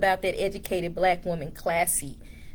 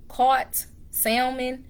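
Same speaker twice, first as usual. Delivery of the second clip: normal talking voice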